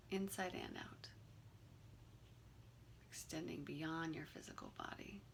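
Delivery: calm, unhurried meditation guide with long pauses; guided meditation. Real, not acted. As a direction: gently lead them through the breathing, leaving room between phrases.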